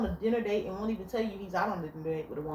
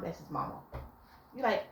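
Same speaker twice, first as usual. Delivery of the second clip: ordinary talk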